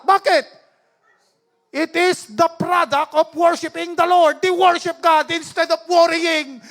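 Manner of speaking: high, forceful preacher delivery; preaching at full force. Real, not acted. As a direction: proclaim it to the congregation, stretching the key phrases. (malakas at mariing pangangaral)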